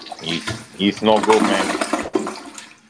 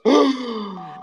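dramatic gasp; 12 semitones higher than usual. Pretend.

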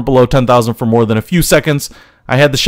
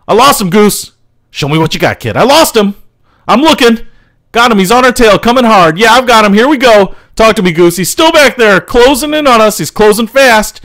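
tense dogfight chatter; 9 semitones higher, 3 dB louder than usual. Acted. Tense dogfight over the radio.

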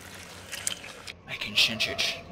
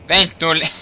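louder; 2 semitones higher, 8 dB louder than usual. Shown as a demonstration. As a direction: say it louder, more urgent. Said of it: "repeats louder".

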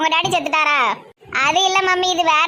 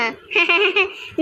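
happy giggle. Acted. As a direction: giggle bashfully before sharing news.

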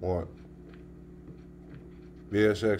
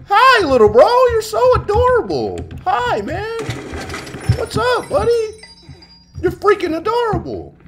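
much higher cutesy cooing; baby talk; 4 dB louder than usual. Acted. Streamer greeting a tiny player avatar, high baby-talk cooing. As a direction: coo at it like a puppy.

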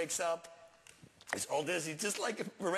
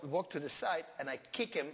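normal talking voice

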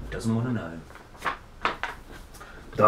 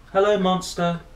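higher, louder friendly hello; Bernard's voice; 8 semitones higher, 5 dB louder than usual. A narrator's reading. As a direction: greet the monster brightly. Bernard greets the monster.

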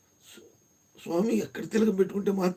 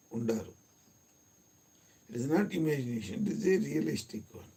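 soft, lowered meditation voice; guided meditation; 3 semitones lower, 5 dB quieter than usual. Real, not acted. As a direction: guide them gently inward, leaving long pauses. (మృదువైన ధ్యాన మార్గదర్శనం)

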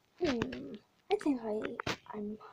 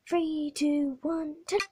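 ordinary delivery

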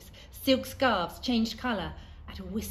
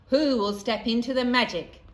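louder, higher showman's call to the crowd; magician calling out; 4 semitones higher, 9 dB louder than usual. A narrator's reading. Magician calls out to the audience, booming showman voice. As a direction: invite the crowd in like a stage magician.

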